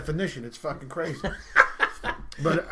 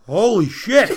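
louder, exclaimed mock shock; shocked doctor; 3 semitones higher, 7 dB louder, slower than usual. Doctor looking at the patient's behind, loud mock shock. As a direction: blurt it out in astonishment.

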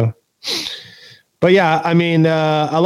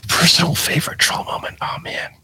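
whispering to himself; 5 semitones higher, 5 dB quieter than usual. Real, not acted. Thinking aloud over a question.

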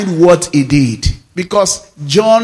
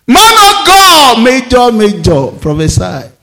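mock the desperate churchgoer crying out; hammed-up worshipper pleading with a prophet; begging believer; 5 semitones higher, 4 dB louder than usual.

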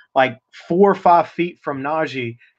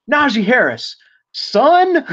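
much higher, louder scolding call; screeching mother; 10 semitones higher, 5 dB louder, slower than usual. Retold or imitated. Mother calling her son back at the bus, high scolding shout. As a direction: summon him like a mom calling her kid. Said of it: "And she said".

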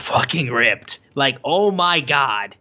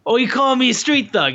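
mock indignant Kingston; 10 semitones higher than usual. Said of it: "And Kingston's like".

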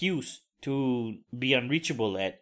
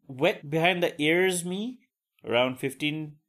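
ordinary talk, nothing marked

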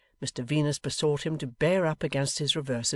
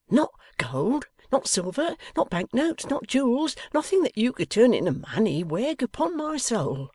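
desperate pleading; 10 semitones higher, 4 dB louder than usual. A narrator's reading.

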